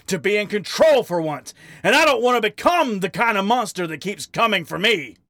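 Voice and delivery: high, shouted tirade; angry outburst. Acted. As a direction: rage at him until it spills out. Harry's raging diatribe at Wally.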